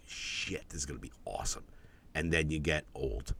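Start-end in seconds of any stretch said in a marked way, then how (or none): 0.1-1.6 imitating excitement, hushed. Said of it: "you're like".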